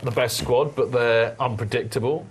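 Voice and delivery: normal talking voice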